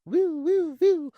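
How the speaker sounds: very high, thin mock siren "woo"; high woo-woo hoots. Strapped-down patient teasing the doctor with very high woo-woo hoots. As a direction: hoot way up high like a spooky whistle. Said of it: "and I went".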